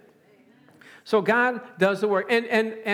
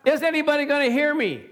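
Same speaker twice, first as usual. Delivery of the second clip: crying out; 6 semitones higher, faster than usual. Acted. Preacher acting Jesus appealing to a crowd, crying out. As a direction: call out for any listener, pitched up. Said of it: "He was crying out".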